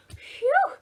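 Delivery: a loud, high puff of relief; high relieved phew. Acted. Teacher showing relief after the fire game with a high phew. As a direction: blow out a big exaggerated phew.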